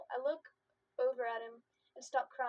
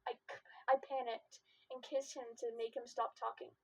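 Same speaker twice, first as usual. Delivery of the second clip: ordinary delivery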